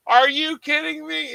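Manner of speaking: high-pitched retold disbelief; can't believe what you're being told; incredulous. Telling mom's celebrity story, incredulous.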